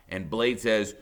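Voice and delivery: usual speaking voice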